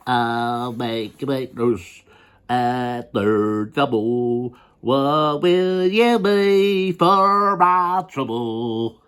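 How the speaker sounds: slow, lightly sung country twang; country singer. Acted. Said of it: "Is a country singer, country western singer".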